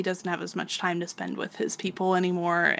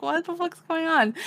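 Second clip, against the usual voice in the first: much higher, confused mock voice; imitating baffled Guts; mock bewildered Guts; 10 semitones higher than usual. Confused Guts, laughing teller.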